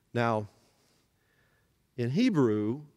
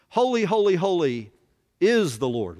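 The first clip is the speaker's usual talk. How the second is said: louder and higher on the first holy; proclaiming; 5 semitones higher, 6 dB louder than usual. Shown as a demonstration. Preacher leading the class in the angels' song, loud. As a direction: ring out Holy, holy like a hymn. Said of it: "Would you say that with me?".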